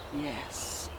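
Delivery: hushed, voiceless assent; intimate whisper. Acted. [name] reliving the proposal, whispered consent. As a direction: breathe it out very softly.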